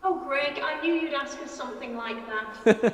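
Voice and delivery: bright laughing reply to the host. Real, not acted. Guest laughing at the host's cheeky question.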